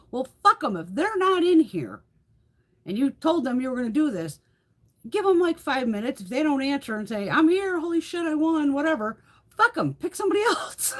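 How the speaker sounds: high, loud mock-indignant rant; exasperated impatience. Viewers fed up with absent giveaway winners, exasperated. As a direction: build to a high, laughing dismissal.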